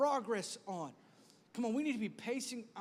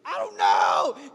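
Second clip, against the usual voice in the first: slow, strained, very loud bellow; yelling back angrily; 11 semitones lower, 16 dB louder, much slower than usual. Acted. Spouse blamed for lost shoes, yelling back angrily. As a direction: stretch the words into an exasperated roar.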